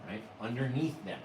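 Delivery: ordinary talk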